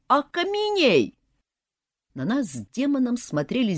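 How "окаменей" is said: high, drawn-out magic yell; terrible cry. A narrator's reading. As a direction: cast the spell with a scream. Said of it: "под страшный крик".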